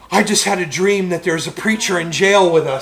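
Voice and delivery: loud, high mock alarm; freaking out. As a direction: blurt it like a panicked man just woken. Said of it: "he's freaking out. He goes".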